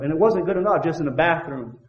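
ordinary delivery

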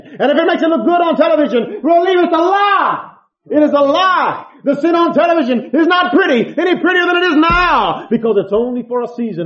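loud, high preaching to the congregation; full-force preaching. Acted.

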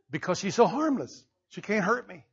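normal talking voice

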